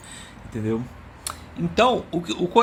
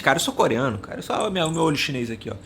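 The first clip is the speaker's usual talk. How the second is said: ordinary talk, nothing marked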